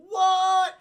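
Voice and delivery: high, loud startled squawk; mock shock. Ape doctor hearing the human's real name, loud high mock shock. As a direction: yelp it in disbelief. Zira stunned by his name.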